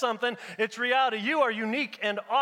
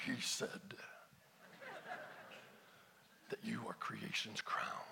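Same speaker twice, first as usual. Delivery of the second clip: whispered voice of God; 13 semitones lower, 21 dB quieter than usual. Acted. Preacher voicing God's words to the congregation, eerie whisper. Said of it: "high-pitched, squeaky voice to say some things. Maybe you would hear it better if I said".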